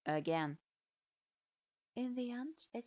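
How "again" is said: stern command. A narrator's reading. Sensei repeats the order.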